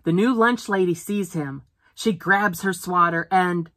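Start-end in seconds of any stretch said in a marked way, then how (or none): none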